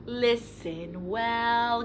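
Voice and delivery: plain reading voice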